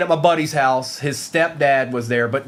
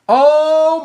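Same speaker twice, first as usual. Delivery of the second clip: excited surprise; 13 semitones higher, 8 dB louder than usual. Real, not acted. Big card pulled on break.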